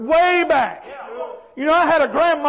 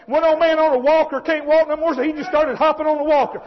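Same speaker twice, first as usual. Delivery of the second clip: ordinary talk, nothing marked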